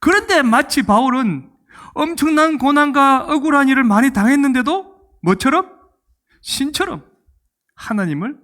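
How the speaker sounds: normal talking voice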